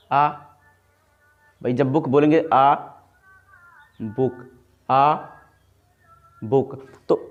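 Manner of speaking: आ open, then बुक with the lips closing; spaced-out आ ... बुक, slowly; sound-by-sound demo. Shown as a demonstration. Teacher drilling students on आ and बुक. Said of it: "lip पहले touch हो रहा ना".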